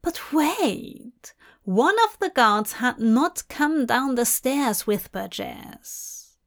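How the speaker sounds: plain reading voice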